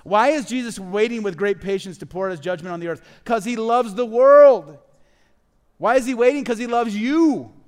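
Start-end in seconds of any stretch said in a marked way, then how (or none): 3.2-6.4 impassioned proclamation. Real, not acted. Preacher telling the church why Jesus waits, loud impassioned proclamation. 6.4-7.5 slow, loud, high preaching cry; impassioned proclamation. Real, not acted. Preacher telling the church why Jesus waits, loud impassioned proclamation.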